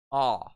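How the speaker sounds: a loud, open "Ah"; "Ah" as in British "pahss"; broad A vowel. Shown as a demonstration. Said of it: "will turn into pass".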